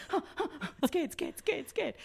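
high, breathy mock panic; mock panicked panting. New girl panicking under the stares, mock panicked panting. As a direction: pant out your fear in little gasps.

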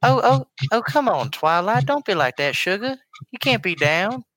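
high Southern pony voice; Southern cartoon farm girl; Applejack voice. Shown as a demonstration. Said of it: "maybe Applejack, 'cause I am Southern".

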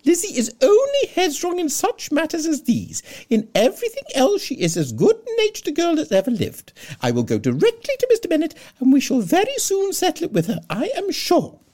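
very high, loud, fluttering matron voice; fussy Mrs Bennet. Acted.

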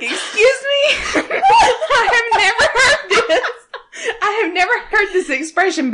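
high, laughing mock outrage; stunned, squealing through laughter at an outrageous phrase; astonished disbelief. Retelling a reaction to the wrestling podcast, astonished and laughing.

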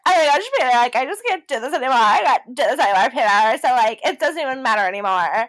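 hammed-up, rapid exasperated outburst; mock freak-out. Co-host replays contestant's fight with Peter, mock freak-out. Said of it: "her freaking out".

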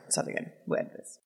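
whispered aside; whispering. Real, not acted. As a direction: breathe it with no voice. Drunk aside whispered to friend.

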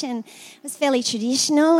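normal talking voice

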